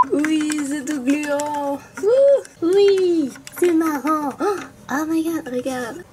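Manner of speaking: high and gleeful, delighted; excited high voice. Acted. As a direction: squeal with childish delight. Second character loving slime.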